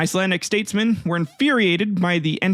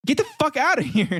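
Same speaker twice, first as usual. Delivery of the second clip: mock yell; 9 semitones higher than usual. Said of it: "They're just like".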